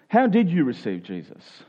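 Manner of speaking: ordinary talk, nothing marked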